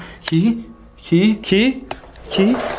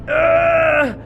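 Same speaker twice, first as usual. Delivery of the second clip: long, high groan of effort; straining cry; 4 semitones higher, 4 dB louder than usual. Acted. Hand stuck reaching for the key, straining cry. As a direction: cry out as you push hard.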